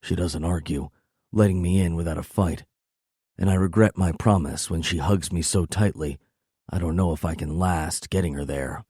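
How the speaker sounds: plain reading voice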